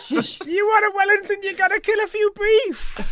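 high goofy chef voice; squeaky silly chef; silly clown. Acted.

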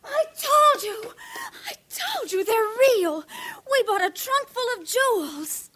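wailing told-you-so, close to tears; tearful outburst. Acted. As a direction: break down shouting at the husband.

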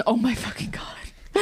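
soft, helpless whispered exclamation; amused, whispered disbelief. Real, not acted. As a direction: breath-only whisper after a laugh. Overcome with amusement at the scene.

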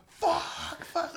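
high mock scream, held back in volume. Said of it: "he's screaming".

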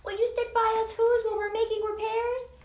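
high, tiny mayor's plea; pleading. A narrator's reading. Tiny mayor asking Horton to stay, pleading. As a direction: beg in a small high voice. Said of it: "pleaded the voice of the mares".

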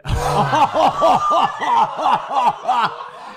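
high, loud, long cackling; real burst of helpless laughing; uproarious laughter. Real, not acted. Prankster after hanging up on George, bursting into laughter. Prank ends, group cracks up.

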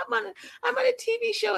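squeaky excited laugh then fast brag; giddy high mock voice. Mocking the newcomer as a giddy reality star. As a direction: play a giddy starstruck newcomer. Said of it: "you're just like".

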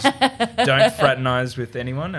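lightly played boss laying down a rule; mock disdainful boss. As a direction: imitate a boss's drawn-out warning. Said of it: "Much to the disdain of my boss".

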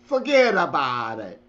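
put-on a light New Jersey mobster accent on forget about it; hint of a New Jersey Italian mobster; Jersey mob accent. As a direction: toss off the line in a mild Jersey mob accent. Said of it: "New Jersey Italiano Mobbish "Forget about it" accent".

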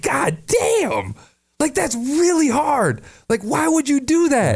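high-pitched, stretched-out shocked reaction; appalled disbelief. Retold or imitated. Retelling his reaction to a friend's cruel plan, appalled. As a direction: protest in high, drawn-out disbelief.

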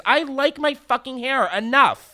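high-pitched, fed-up rant; exasperated. Real, not acted. Podcaster venting about hair comments, exasperated. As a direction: push the pitch up in annoyance.

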